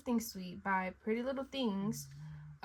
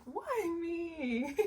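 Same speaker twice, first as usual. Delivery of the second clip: crying; 7 semitones higher than usual. Real, not acted. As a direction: voice cracking with tears. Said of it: "ugly cry".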